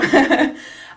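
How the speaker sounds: short amused giggle; real laugh. Real, not acted. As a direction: burst of high laughter. Laughing at bathroom topic.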